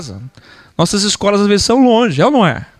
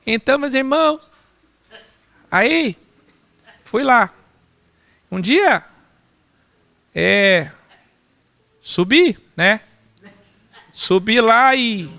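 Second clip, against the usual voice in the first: mock timid speaker; 5 semitones higher, slower than usual. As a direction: play the shy stumbling speaker. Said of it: "ficar assim".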